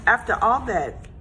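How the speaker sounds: ordinary delivery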